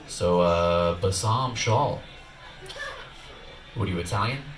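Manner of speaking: low, slow mock cop reading; puzzled cop. Cop reads the license aloud, slow and low.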